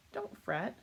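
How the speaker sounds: a tiny mouse soothing the bear; squeaky mouse. Acted. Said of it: "the mouse squeaks".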